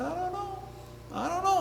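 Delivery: small, high, soft rabbit voice; little animal playing unsure; doubtful rabbit. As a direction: murmur it high and coy.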